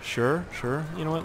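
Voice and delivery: normal talking voice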